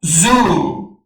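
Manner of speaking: زو sounded like English "zoo"; English pronunciation demo. Shown as a demonstration. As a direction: say زو as "zoo", buzzed z and long oo.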